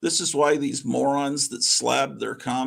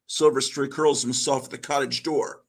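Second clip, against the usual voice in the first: plain reading voice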